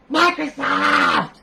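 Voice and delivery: high, drawn-out yell; exasperated shout. Real, not acted. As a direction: holler it long and loud. Repeats his exasperated jab at Microsoft.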